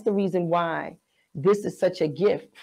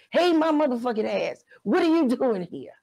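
high-pitched indignant retort, retold; stern mother; 6 semitones higher than usual. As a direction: imitate a strict mother scolding an unwelcome guest. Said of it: "My mother is so stern".

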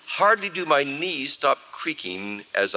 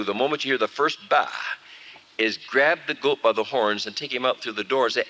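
usual speaking voice

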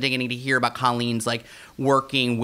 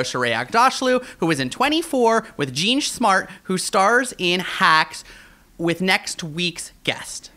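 dramatic reveal build; 6 semitones higher, slower than usual. Acted.

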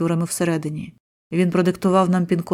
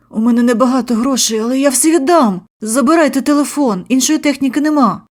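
higher, louder frightened pleading; pleading victim; 6 semitones higher, 6 dB louder than usual. A narrator's reading. Victim begging the robbers, high and pleading. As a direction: beg them to take everything.

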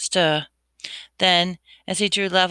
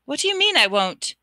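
alarmed; 9 semitones higher than usual. Acted. Harry alarmed by Riddle.

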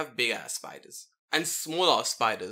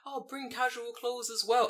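mock boss voice; 9 semitones higher than usual. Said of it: "And they'll go".

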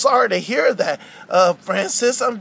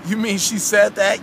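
usual speaking voice

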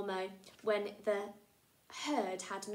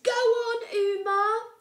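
high, loud urging cry; aunties calling out; 13 semitones higher, 13 dB louder, slower than usual. Acted. Aunties cheering Uma on loudly. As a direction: egg her on from the crowd.

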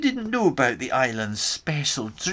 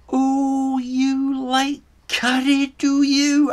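teasing question; 8 semitones higher, 3 dB louder, slower than usual. Retold or imitated. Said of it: "I asked".

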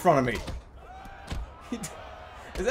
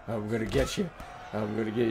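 low, slurred tough-guy taunt; drunk brawler voice; 11 semitones lower, 7 dB quieter, faster than usual. Pretend. Low tough-guy voice voicing the drunk game fighter. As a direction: growl like a drunk looking for a fight.

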